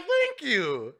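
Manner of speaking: high-pitched, hammed-up relief; exaggerated, high cry of agreement; emphatic vindication. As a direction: act out finally being agreed with. Retelling relieved agreement with reviewers.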